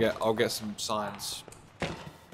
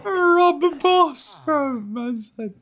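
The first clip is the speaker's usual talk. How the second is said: high, laughing squeal; cracking up; 5 dB louder, much slower than usual. Real, not acted. Friend amused by the pet name, cracking up. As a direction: repeat it helpless with laughter.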